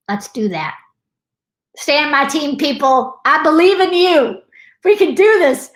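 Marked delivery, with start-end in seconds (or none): 1.8-5.7 high, louder pep-talk cheer; rally them like your own team; rallying encouragement. Real, not acted. Host urging viewers to stay on the team, rallying encouragement.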